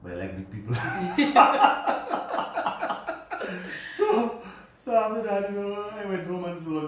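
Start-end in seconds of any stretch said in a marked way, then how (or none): 0.8-3.9 long loud laughing burst; real laugh at his own confession. Real, not acted. Laughing about liking big people. 4.0-6.9 talking through laughter, higher; words still shaking with a laugh. Real, not acted.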